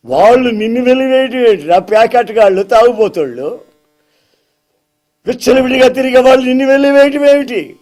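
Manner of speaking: fast, indignant preacher-Jesus. Acted. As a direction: scoff at those who cast him out. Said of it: "Jesus indignant at the outcasting".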